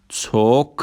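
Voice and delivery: "توک" said alone as Turkish çok; Turkish ç demo. Shown as a demonstration. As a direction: model "توک" as çok for learners.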